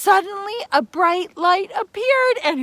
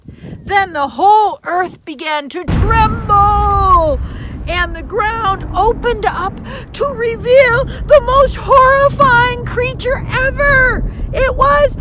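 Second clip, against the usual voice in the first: loud, high, rising suspense narration; dramatic build-up; 5 semitones higher, 8 dB louder than usual. A narrator's reading. Storyteller building suspense before the monster appears. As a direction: build the terror as the ground opens.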